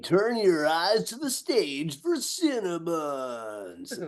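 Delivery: slow, drawn-out club MC call; strip club DJ. Pretend. Intro as a strip club DJ, slow and hammy. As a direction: announce the act like a sleazy DJ. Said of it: "I'm gonna do it like a strip club D J".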